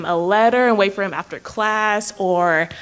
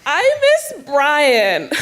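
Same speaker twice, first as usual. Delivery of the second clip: wistful longing; 6 semitones higher, 4 dB louder, much slower than usual. Acted. Lovesick sigh over ex.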